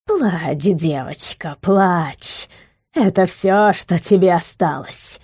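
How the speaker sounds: put-on soft, grating old hag; grating crone voice. A narrator's reading. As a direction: scrape the words out like a crone. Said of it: "проскрежетала и Елениль".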